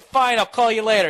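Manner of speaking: loud, high huffy sign-off; retold yelling. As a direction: end the call in exasperation. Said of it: "I yelled at him so much".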